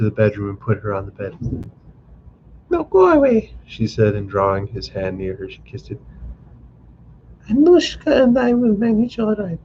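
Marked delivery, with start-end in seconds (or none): 2.7-3.5 high, louder gentle dismissal; young wife. A narrator's reading. Liza sends husband off. 7.5-9.6 high, reassuring voice; young wife. A narrator's reading. Liza reassures husband.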